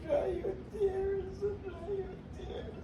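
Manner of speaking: high, tearful pleading through sobs; crying while telling herself to stop; real crying. Real, not acted. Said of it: "Oh, Father, forgive me".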